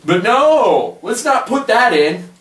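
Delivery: mock their decision, thoroughly annoyed; salty. Real, not acted.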